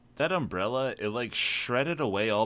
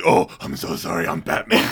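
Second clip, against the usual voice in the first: low, louder apologetic Batman; a sheepish, lower Batman apology; mock Batman; 7 semitones lower, 4 dB louder than usual. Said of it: "He's like".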